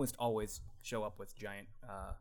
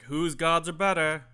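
smug taunt; 7 semitones higher, 10 dB louder, slower than usual. Acted. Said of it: "a look that just says".

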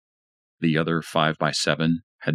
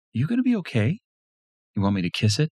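Lisa comforting; 9 semitones higher, faster than usual. A narrator's reading. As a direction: gently soothe him like a lover. Lisa comforts hurt husband.

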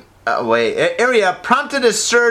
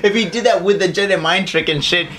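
ordinary talk, nothing marked

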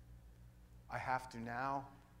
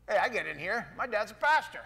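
louder, higher mock-confident claim; confident excuse; 5 semitones higher, 6 dB louder than usual. Man telling God his dad's a pastor, mock-confident. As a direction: plead your case a little too proudly.

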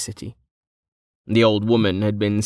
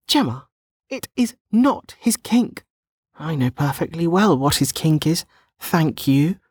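put-on high, emphatic young woman setting her straight; indignant denial; 7 semitones higher than usual. A narrator's reading. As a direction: deny it primly in a high character voice.

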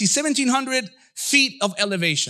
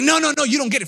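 higher, urgent no no no; insistent; 6 semitones higher, 3 dB louder, much faster than usual. Acted. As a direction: cut them off eagerly. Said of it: "You're like".

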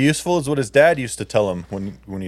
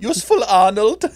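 put-on much higher, louder falsetto with accent; mock falsetto dad; 10 semitones higher, 5 dB louder than usual. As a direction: squeal it like Arnold's falsetto father. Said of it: "his dad was a falsetto".

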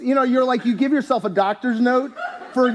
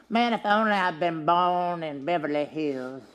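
mock whiner; 5 semitones lower, 6 dB quieter than usual.

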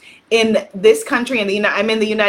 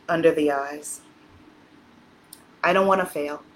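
guided tapping script; 6 semitones lower, 3 dB quieter than usual. Acted. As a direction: lead the listener gently through each tapping point. Leads EFT tapping affirmations.

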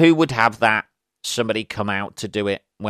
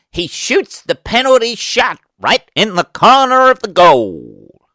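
much higher, louder mock American sports commentator; 9 semitones higher, 7 dB louder than usual. Brit mocking American soccer commentators, American accent. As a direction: hype it like a US soccer announcer. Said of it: "I love watching American reporters".

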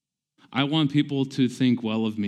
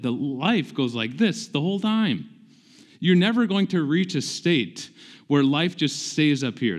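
raised, emphatic preacher's voice; preaching; 4 semitones higher, 3 dB louder than usual. Acted. Pastor exhorting brothers and sisters, emphatic preaching. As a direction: proclaim it to the congregation like good news. Preaching about great news.

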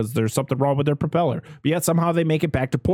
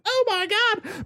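high-pitched imitated alarm; squealed mock horror; mock panic; slower than usual. Voicing the panicked people on the boat.

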